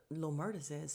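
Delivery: ordinary delivery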